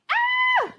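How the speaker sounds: high delighted squeal; real squeal of excitement over the food; excited squeal. Real, not acted. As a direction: let out a thrilled shriek of joy. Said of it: "Let me calm down".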